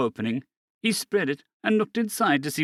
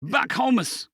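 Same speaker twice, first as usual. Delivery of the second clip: yelling; 10 semitones higher than usual. A narrator's reading.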